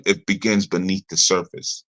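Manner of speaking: usual speaking voice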